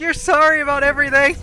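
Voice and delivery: high, loud comic accusation; mock exasperated accusation. Friend accusing her of over-apologising, high exasperated voice. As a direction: accuse loudly, imitating the fed-up friend.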